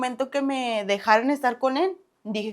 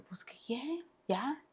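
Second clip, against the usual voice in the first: voiceless, much softer grumble back; whispered retort; 2 semitones higher, 15 dB quieter, much slower than usual. Retold or imitated. Answering her mother, a voiceless, much softer annoyed whisper.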